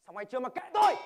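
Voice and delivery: loud, irritated snap back; irritated retort. Acted. Driver snaps at the nagging passenger, irritated and loud. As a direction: tell him to mind his own business.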